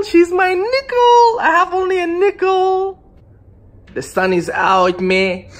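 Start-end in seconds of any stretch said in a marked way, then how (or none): 0.0-1.4 much higher, slower, playful put-on voice; high squeaky voice. Repeats phrase in put-on voice. 1.4-3.0 high silly voice. Pretend. Continues put-on voice.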